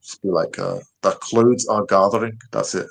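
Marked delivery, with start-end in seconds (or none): 1.0-2.4 slow Scottish accent, "clouds" said as "cloods"; drawn-out attempt at a Scottish accent. Shown as a demonstration. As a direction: do a careful one-line Scottish accent. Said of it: "like a Scottish accent".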